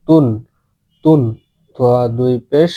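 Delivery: ordinary delivery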